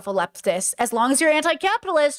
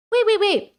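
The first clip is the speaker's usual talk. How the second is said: higher and louder sudden stop; alarmed wait; 7 semitones higher, 3 dB louder than usual. Real, not acted. Fact-checking herself live, a startled higher wait. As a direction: catch yourself mid-thought, startled. Realising her own mistake.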